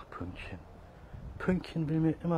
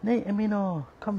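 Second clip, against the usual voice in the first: high call; 9 semitones higher, 6 dB louder than usual. Real, not acted. Calling cat, high and loud.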